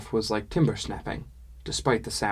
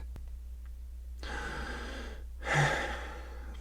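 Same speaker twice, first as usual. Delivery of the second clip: long breathy sigh; weary sigh; 5 semitones higher, 9 dB quieter than usual. A narrator's reading. Kalka sighing before giving orders, long weary sigh. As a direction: let all the air out, tired. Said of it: "and she sighed".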